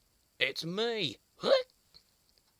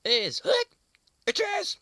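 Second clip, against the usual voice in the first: high, loud squeaky complaint; high squeaky plea; 11 dB louder than usual. Acted. Squirrel Monkey wailing to Leo about hiccups, high squeak. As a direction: wail it up high like a small monkey.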